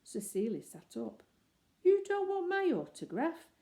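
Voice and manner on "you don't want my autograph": much higher, louder disbelief; stunned he is refused; surprised. Acted. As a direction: actor caught off guard by the bear. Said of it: "he said in a surprised voice".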